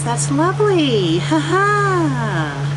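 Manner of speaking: high sarcastic drawl. Real, not acted. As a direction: say it dripping with sarcasm. Sarcastic remark.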